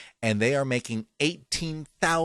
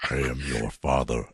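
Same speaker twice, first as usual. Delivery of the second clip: Darth Vader voice; 10 semitones lower, 5 dB quieter than usual. Retold or imitated. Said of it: "James Earl Jones".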